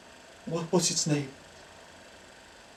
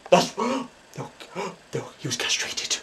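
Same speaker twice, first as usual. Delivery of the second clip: gasping shock; 8 dB quieter than usual. Acted. Psychic shocked by a vision.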